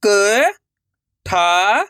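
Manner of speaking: क, ठा each held long and rising; letter-by-letter demo. Shown as a demonstration. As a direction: sound out क and ठा separately. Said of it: "एक अक्षर का उच्चारण सही से". (क, ठा अलग-अलग खींचकर)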